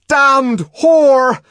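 high, loud enraged bellow; furious shout, high and loud. Acted. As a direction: spit the insult out in fury. Savage shouts at her.

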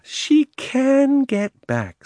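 high, very slow, drawn-out retort; smug Uncle Andrew. Acted. Uncle springs his trap on Diggory, smug. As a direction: spell it out slowly from on high.

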